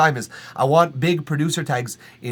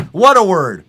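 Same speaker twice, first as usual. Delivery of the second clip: higher and louder delight; 5 semitones higher, 10 dB louder than usual. Real, not acted. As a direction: savour the word gleefully. Repeats line louder.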